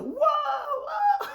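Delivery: high, loud comic wail of shock; imitated whoa. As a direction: whoop it out, mimicking a friend's big reaction. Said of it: "you see Garcelle, like, just, like, giving".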